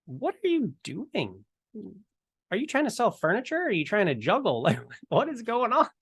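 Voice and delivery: high-pitched mock incredulity; exaggerated bewilderment, pitch leaping up with a laugh creeping in; baffled disbelief. Mock disbelief at goofy salesman.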